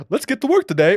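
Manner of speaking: high, loud cheerful work-ready toilet; pep up like a toilet starting a shift; eager talking toilet. Pretend. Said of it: "either he's like".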